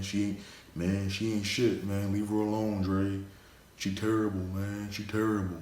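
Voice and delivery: lightly voiced impression of teammates warning a friend; Charles Barkley. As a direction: loosely slip into a Barkley-like voice. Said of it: "I sound like Charles Barkley and shit".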